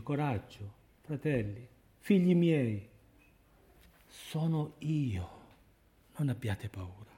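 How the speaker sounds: put-on tender words to frightened children; gentle reassurance. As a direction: soothe them softly, phrase by phrase. Voicing Jesus comforting the fearful faithful.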